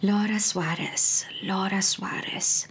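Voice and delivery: slow, deliberate, trying the name out; practicing a new name slowly. Acted. Impostor rehearsing her stolen name, slow and careful. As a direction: say it slowly as if memorizing it. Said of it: "masanay ako kaagad sa pangalang".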